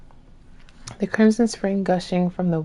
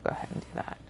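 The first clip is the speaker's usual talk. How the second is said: whispering; 17 dB quieter, much faster than usual. Real, not acted. Whispering to herself.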